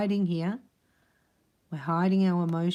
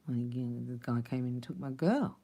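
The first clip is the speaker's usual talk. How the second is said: low, soft acted confession; hushed, defeated admission; subdued confession; 5 semitones lower, 5 dB quieter than usual. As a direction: recall an old hurt quietly, voice dropped. Confessing old hurt in his voice.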